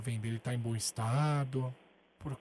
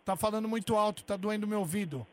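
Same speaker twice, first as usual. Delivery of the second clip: louder fake complaint about the volume; mock complaint; 7 semitones higher, 5 dB louder than usual. Prank caller to the seller, a louder mock complaint. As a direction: pretend the caller's voice is hurting your ear.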